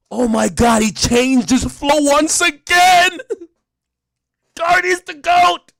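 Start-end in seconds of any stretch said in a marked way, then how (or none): 0.1-3.2 high, loud, slow gush; hammed-up fan awe, much higher; mock gushing fan. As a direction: marvel like a superfan. Fans gushing about [name].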